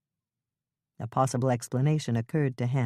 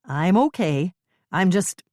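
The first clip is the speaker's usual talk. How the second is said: louder, steady voice calling up; calling up steadily; 4 semitones higher, 6 dB louder than usual. Acted. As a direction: call up from the ditch, steady. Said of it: "how strong and steady her voice was".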